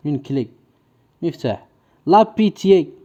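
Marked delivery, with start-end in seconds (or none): 2.1-2.9 higher-pitched, deliberate dictation. Shown as a demonstration. Teacher giving the class a new word, dictation voice. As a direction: announce the word for pupils to write.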